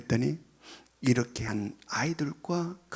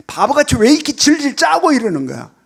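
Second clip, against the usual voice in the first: much higher, loud mocking scold; scolding parent; 9 semitones higher, 14 dB louder than usual. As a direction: scold the crying child shrilly. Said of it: "라고 혼내지 말고". (우는 아이를 큰 소리로 나무라는 부모)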